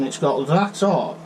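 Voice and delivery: ordinary delivery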